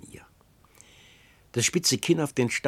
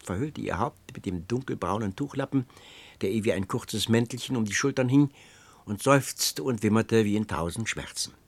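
ordinary delivery

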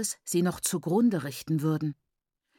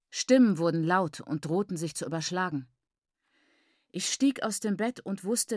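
ordinary delivery

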